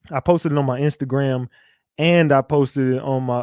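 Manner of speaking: ordinary delivery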